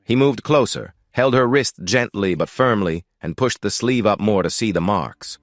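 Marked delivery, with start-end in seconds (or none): none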